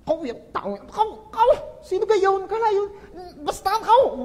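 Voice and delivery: high, whiny mock rage; mock fury. Pastor imitating an angry person seeing an enemy, high mock rage. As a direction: act out sputtering anger, hammed up. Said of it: "Galit na galit".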